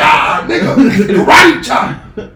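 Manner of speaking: drawn-out, high, hammed-up battle cry; mock karate yell. Bragging to friends he'd attack Apocalypse, mock karate yell. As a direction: shout it like a pretend karate attack.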